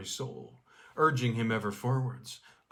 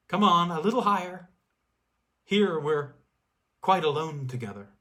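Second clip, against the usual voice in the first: higher, louder, quick coaxing call; luring snow spirit; 5 semitones higher, 7 dB louder than usual. Acted. Snow girl lures the skier higher, playful call. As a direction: beckon him upward, playful and eerie.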